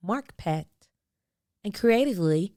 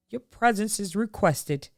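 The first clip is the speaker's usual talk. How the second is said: stately invitation voice; formal invitation; 3 dB quieter than usual. A narrator's reading. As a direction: read it like a grand announcement, slowing at the end.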